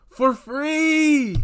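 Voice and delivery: high, mocking sing-song "for free"; mocking quote. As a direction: sneer it like the friend in the text. Reading the friend's mocking jab.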